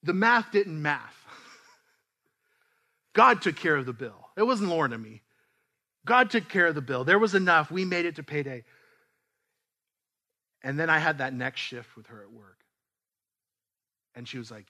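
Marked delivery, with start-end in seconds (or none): 0.0-8.6 louder, building proclamation of God's provision; emphatic testimony. Real, not acted. Pastor to the church, proclaiming loudly. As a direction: preach it out with rising conviction.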